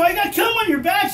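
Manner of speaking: high, loud panicked announcement; mock shout. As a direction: blurt it out loud. Telling wife what happened.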